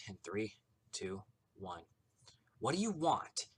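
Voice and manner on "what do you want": higher-pitched exasperated question; through gritted teeth. A narrator's reading. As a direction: contain your anger behind clenched teeth. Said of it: "she asked through gritted teeth".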